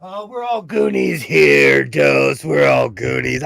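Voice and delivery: loud, slow shouted line; shouted movie quote. Acted. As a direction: call it out like the movie. Goonies quote called out.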